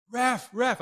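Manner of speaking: high, loud mock coach shout; yelling coach. Coach yelling at the ref mid-game, retold as a high shout. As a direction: imitate a coach hollering for attention. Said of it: "Coach starts yelling at me".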